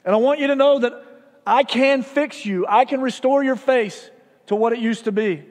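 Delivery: a surgeon calling loudly through the bedroom door. Retold or imitated. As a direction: project it so the man behind the door hears. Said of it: "he called out loudly".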